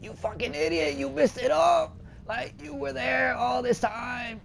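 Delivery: high, slowed mock scolding from an imagined viewer; mocking a frustrated viewer, pitched high; mock angry viewer. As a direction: ham up an annoyed commenter yelling at you. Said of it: "I bet somebody is probably saying".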